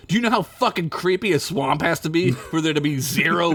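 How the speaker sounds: ordinary talk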